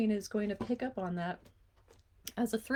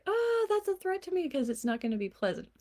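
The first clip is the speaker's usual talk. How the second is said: mock alarmed brain; 10 semitones higher than usual. Voicing the kid's brain.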